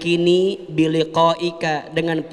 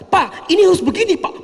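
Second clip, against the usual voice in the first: high, loud insistent plea to the boss; hammed-up pushy wife; pushy demand; 12 semitones higher, 4 dB louder than usual. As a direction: push it on him, high and loud.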